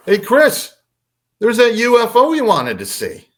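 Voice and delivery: high, loud taunting call; imitating a mother needling her son from across the room; teasing mom. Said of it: "sounded like she was busting my balls".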